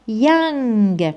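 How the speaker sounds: يانغ drawn out as "yang", starting on y, ringing ng; pronunciation demo. Shown as a demonstration. Teacher shows students how it is pronounced, slow y-sound demo. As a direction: stretch يانغ with a released g ending. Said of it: "بصح شوف كيفاش راح ننطقها".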